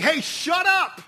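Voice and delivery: high, loud telling-off; exasperated, punchy command; sassy shout. Acted. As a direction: yell it like someone fed up with the racket. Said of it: "sassy comments he's making".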